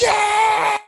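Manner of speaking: very high, screamed cheer; primal scream. Retold or imitated. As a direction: let out a primal yell of hype. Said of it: "just primal scream".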